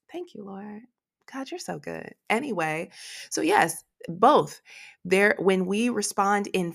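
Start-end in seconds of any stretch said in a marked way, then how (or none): 0.1-2.1 soft, murmured prayer; whispered gratitude; hushed thanks. Real, not acted. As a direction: breathe a quiet thank you to God.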